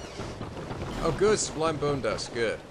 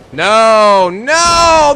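loud, climbing cries of no; real dismay, yelled; anguished shout; 7 semitones higher, 9 dB louder than usual. Real, not acted. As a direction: wail it higher the second time. Another failure in game.

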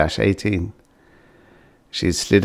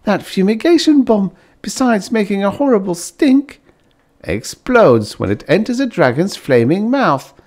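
high, louder delighted wife; a wife thrilled with her new home; gushing Bliss; 12 semitones higher, 4 dB louder than usual. A narrator's reading. Bliss explains the bomb.

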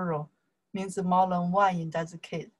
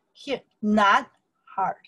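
normal talking voice

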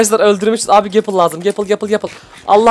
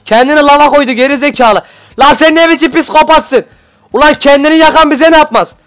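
high, loud disbelief; stunned gamer outburst; incredulous yell; 7 semitones higher, 4 dB louder than usual. Real, not acted. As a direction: shout at the crazy enemy. Enemy jumped in lava.

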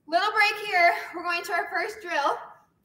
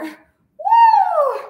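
high, loud celebratory whoop; excited cheer; 13 semitones higher, 12 dB louder than usual. Real, not acted. Cheering after the final set.

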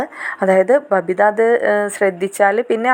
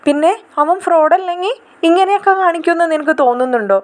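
angry retort; 7 semitones higher than usual. Acted. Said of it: "ദേഷ്യം വരാണ്".

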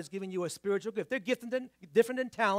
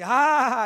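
loud, high imitated "ha-ha"; mock knowing laugh; 5 semitones higher, 12 dB louder than usual. Listener spotting the preacher's accent, mock knowing laugh. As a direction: imitate someone loudly catching you out. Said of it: "someone say".

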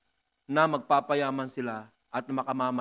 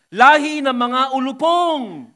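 slow, loud, high denunciation; prophet's thundering cry; 10 semitones higher, 15 dB louder, much slower than usual. Acted. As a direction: hurl the curse like John the Baptist. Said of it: "sinabi niya sa kanila". (dumadagundong na sigaw ng propeta)

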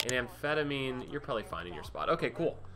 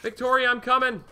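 excited call; 10 semitones higher, 7 dB louder than usual. Acted. Calling buyer playfully.

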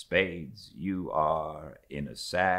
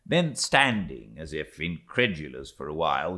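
usual speaking voice

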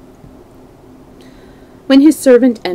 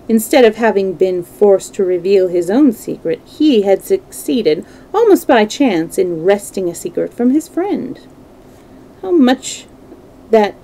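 ordinary delivery

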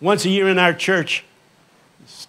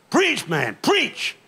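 deacons yelling preach; 8 semitones higher, much slower than usual. Retold or imitated. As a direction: call out preach, rising high on the last preach. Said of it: "they yell".

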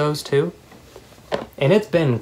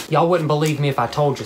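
normal talking voice

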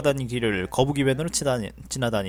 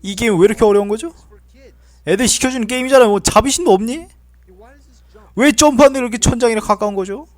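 exasperated rant; 5 semitones higher, 5 dB louder than usual. Real, not acted.

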